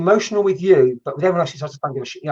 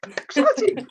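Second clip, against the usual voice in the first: excited; 14 semitones higher, slower than usual. Real, not acted. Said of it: "it's the first time I've done this".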